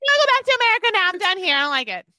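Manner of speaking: high, loud whiny wife done fast at first; playing Holly. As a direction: wail it like a homesick wife who has had enough. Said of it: "let me be the person opening the door and you be her".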